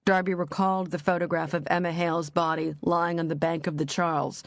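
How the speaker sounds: usual speaking voice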